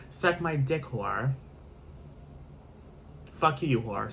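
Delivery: usual speaking voice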